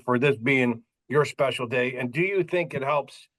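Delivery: normal talking voice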